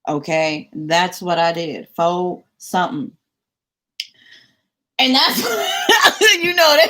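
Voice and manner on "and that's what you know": real laugh breaking through the words; laughing out loud at the memory. Real, not acted. Telling a friend about the wig, laughing hard.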